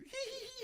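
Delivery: very high mock giggle; imitated giggle. Pop star calling back laughing, mimicked high giggle. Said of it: "and he's laughing".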